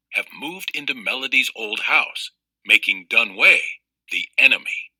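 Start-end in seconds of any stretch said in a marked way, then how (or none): none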